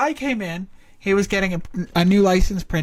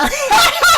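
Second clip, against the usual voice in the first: loud high laughing fit; burst of laughter; 10 dB louder than usual. Real, not acted. Player cracks up at the game line, loud laughter. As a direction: crack up loudly.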